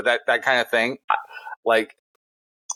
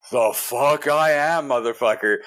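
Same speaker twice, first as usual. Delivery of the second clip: stretched-out, higher angry retort; pissed off; 5 semitones higher, slower than usual. Retold or imitated. Angry resident retorting to the older neighbour who confronts him, pissed off. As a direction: throw it back indignantly, drawing it out. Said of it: "I'm so fucking pissed".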